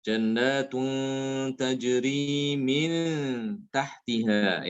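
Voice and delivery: slow chant, long nasals on "Jannatun" and "min"; ikhfa before ta. Shown as a demonstration. Teacher repeats the two examples, slow held nasal. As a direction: stretch "Jannatun" and "min" into hums. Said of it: "tanwin bertemu dengan ta".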